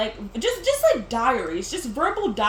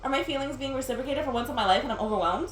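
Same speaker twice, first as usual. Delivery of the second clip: fast hushed rush; 5 dB quieter, faster than usual. Acted. First take, fast and hushed.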